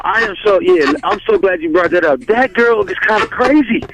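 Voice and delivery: normal talking voice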